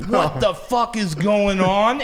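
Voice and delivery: mock stunned reaction to the singer's threat; stunned disbelief. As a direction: stretch it out, dumbfounded.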